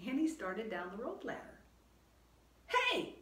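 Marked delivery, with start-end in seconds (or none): 2.7-3.2 high, loud shout; high, loud alarmed shout; shouted hey. Acted. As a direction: shout after her to stop. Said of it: "shouted Jack".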